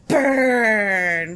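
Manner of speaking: loud, smug playground taunt; kid's taunt. Retold or imitated. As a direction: gloat it like a cheeky kid. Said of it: "looked at me and went".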